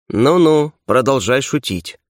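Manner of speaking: usual speaking voice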